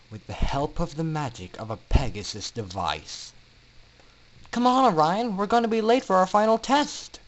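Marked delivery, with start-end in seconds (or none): 4.5-7.2 high, loud call to a friend; Scootaloo calling. Acted. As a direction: call out high and bright, hurrying him. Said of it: "Scootaloo called to a friend".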